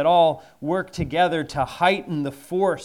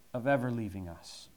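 usual speaking voice

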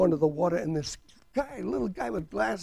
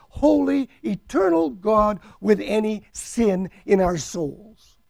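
preaching at full force; 7 semitones higher, 8 dB louder than usual. Acted. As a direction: hammer each word home to the congregation.

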